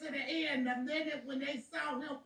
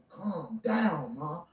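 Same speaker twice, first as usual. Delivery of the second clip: son's voice; 6 semitones lower, 7 dB louder, much slower than usual. Retold or imitated. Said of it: "my baby in my ear telling me".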